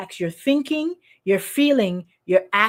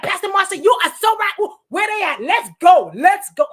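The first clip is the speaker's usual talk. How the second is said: high, shouted hype; loud, fired-up bandwagon cheering; getting loud; 6 semitones higher, 5 dB louder than usual. Retold or imitated. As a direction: imitate a friend rallying for a fight. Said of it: "Okay, let's get loud".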